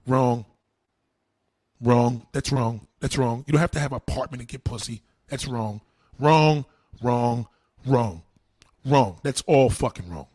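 loud, low, punchy "Wrong."; hammering "Wrong" again and again; emphatic loud rebuttal. Real, not acted.

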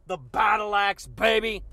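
ordinary talk

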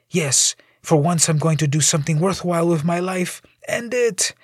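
mild despair, giving up; a hint of a despairing man. Despairing jumper voiced.

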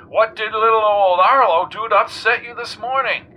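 jaunty talk-radio host. A narrator's reading. Arlo Wombat quizzing the weatherman on air, breezy host.

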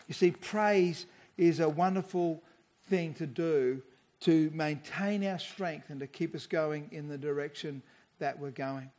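ordinary delivery

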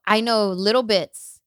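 ordinary delivery

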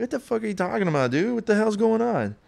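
high, fast, rattled questions; firing back confused and spooked; retold alarm. Answering his scared friend, high, fast and rattled.

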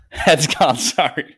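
laughing through the words at own muddle; sheepish laughing apology. Real, not acted. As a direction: giggle out an apology.

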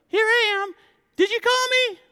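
put-on high little boy voice; a sleepy boy running to Eli. Voicing young Samuel.

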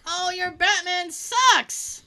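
slow, high, drawn-out jeer; mock heckler's holler; heckling yell. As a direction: stretch the insult out for the whole hall. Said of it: "just yell".

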